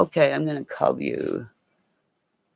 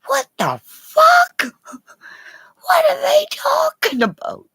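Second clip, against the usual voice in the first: confused viewers; 8 dB louder than usual. Pretend. Imagined viewers baffled by her chat, high squealing disbelief. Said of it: "everybody's probably going".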